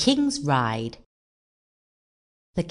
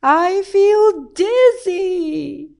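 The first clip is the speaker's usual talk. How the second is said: very slow, high, wobbly-long wail; dizzy shout; 14 semitones higher, 6 dB louder, much slower than usual. A narrator's reading. King swinging up high feels sick, dizzy shout. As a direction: cry out loudly, dragging it right out. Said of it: "he shouted".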